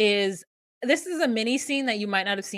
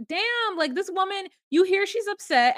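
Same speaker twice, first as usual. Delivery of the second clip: high-pitched disbelief; indignant; 10 semitones higher, 3 dB louder than usual. Real, not acted. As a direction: call out the caller, pitched way up. Indignant at caller asking for money.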